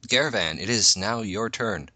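ordinary talk, nothing marked

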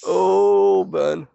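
high mock-shocked oh; scandalised. Real, not acted. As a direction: gasp at a dirty joke.

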